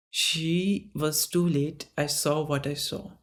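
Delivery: ordinary delivery, nothing marked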